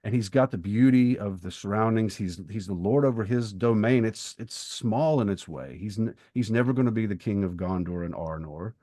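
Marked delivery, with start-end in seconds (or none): none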